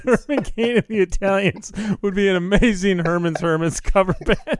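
speaking while laughing. Real, not acted. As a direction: grin and giggle through it. Laughing at band name idea.

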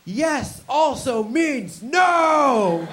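high, loud, very slow insistence with a laugh; emphatic lecture. Retold or imitated. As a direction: drill the point in like a zealot. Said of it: "She's like".

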